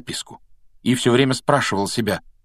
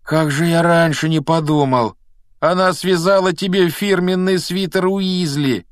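high, loud wail of realisation; embarrassed dismay; 8 semitones higher, 4 dB louder than usual. Acted. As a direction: lament it like an embarrassed boy. Ron groans in embarrassed dismay.

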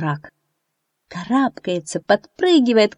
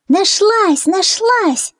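very high delighted cries; joyful shout; 13 semitones higher, 4 dB louder, much slower than usual. A narrator's reading. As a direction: cheer the good news. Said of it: "закричали Фью и Мармеладик".

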